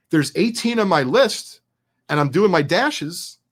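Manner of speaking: ordinary talk, nothing marked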